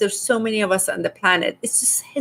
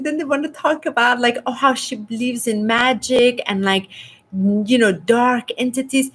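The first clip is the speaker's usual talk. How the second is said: ordinary talk, nothing marked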